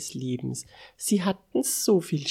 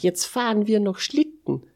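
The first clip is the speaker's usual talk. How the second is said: higher-pitched, keen announcement; eager calling out; 6 semitones higher, 4 dB louder than usual. A narrator's reading. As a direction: call out the next bit of fun. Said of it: "rief Mister Unsinn". (unternehmungslustig rufen)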